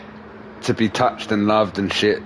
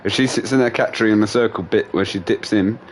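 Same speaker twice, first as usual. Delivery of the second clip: usual speaking voice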